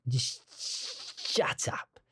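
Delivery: drawn-out, hissing whisper; hushed self-scolding. Pretend. Musician scolding himself over his mistake, slow hissed whisper. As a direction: whisper it slowly, stretching the sh.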